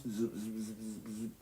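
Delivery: soft quick zoop zoop sounds; zapping noises. Retold or imitated. Explaining the brain to viewers, soft zap noises. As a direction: imitate little neuron zaps. Imitating neuron zaps.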